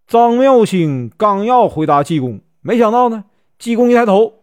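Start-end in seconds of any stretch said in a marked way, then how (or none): none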